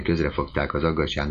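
usual speaking voice

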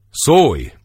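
high, loud order; loud command. A narrator's reading. King orders Tiborc to speak, loud. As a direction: snap out the command.

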